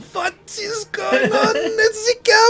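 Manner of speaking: high, slow panicked yelling guard; alarmed soldier yell. Acted. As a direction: cry out in alarm. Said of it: "he's gonna go".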